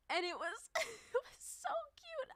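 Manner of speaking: very high, cracking laugh-cry; real tears breaking through a laugh; teary laugh. Real, not acted. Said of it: "I'm literally crying".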